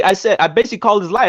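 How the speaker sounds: ordinary talk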